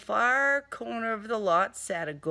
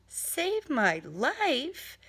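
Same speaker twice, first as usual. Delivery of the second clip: slow, higher, scoffing disbelief; incredulous; 8 semitones higher, much slower than usual. Acted. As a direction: drag it out in disbelief. Incredulous reaction to the note.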